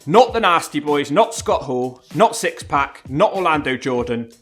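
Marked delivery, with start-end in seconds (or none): none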